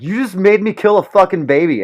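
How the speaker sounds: high, loud accusing yell; hammed-up enraged shout; mock furious minion. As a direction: shriek it at the governor in outrage. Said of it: "one of his minions is just like".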